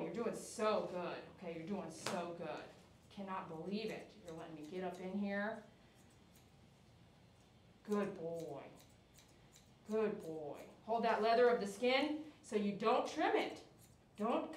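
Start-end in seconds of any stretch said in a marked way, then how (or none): 0.0-10.6 soft, low reassurance; calm the dog down gently; soothing praise. Real, not acted. Groomer softly praising a nervous dog, quiet and low.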